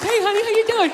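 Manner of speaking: high, falsely sweet hey honey; high syrupy greeting to his girl; mock sweet greeting. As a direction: put on a sugary voice for her. Greeting the girlfriend.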